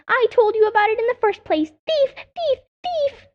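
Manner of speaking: shrill, very high screeching cry; screaming jay. Acted. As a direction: screech it like an outraged bird. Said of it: "screamed Sammy".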